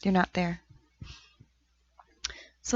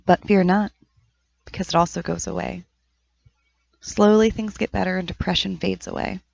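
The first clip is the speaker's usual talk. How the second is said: louder, projected poetry reading; poem recitation; 2 semitones higher, 6 dB louder than usual. Acted. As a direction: recite it with measured pauses between the lines. Reads own poem aloud.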